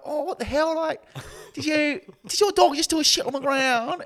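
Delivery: very high, loud accusing skit voice; mock outrage. Bystanders accuse her over the dog's mess, mock outrage. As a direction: squawk it like a scandalised bystander.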